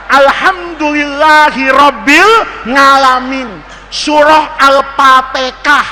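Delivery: slow, heavy Javanese recitation, ng in ngalamin; Javanese accent. Acted. Teacher imitates Javanese reciting by habit, slow Javanese accent. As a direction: recite slowly with Javanese consonants, P for F. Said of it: "Orang Jawa".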